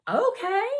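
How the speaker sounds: put-on girl happily agreeing on the movie choice; cheerful. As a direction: chirp it happily. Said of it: "cheerfully taking the case".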